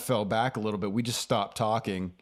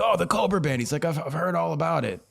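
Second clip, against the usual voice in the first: Stone Man enthusing; 7 semitones higher than usual. Retold or imitated.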